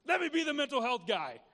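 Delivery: an eager volunteer stepping forward. Retold or imitated. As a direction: throw your arms open to the role. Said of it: "Now I'm like".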